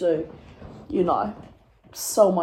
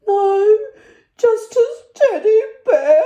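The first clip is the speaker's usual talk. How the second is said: loud, very high wail; hammed-up tearful shriek; mock hysterical; 13 semitones higher, 7 dB louder, slower than usual. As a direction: squeal it out as if near tears. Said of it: "I was hysterical".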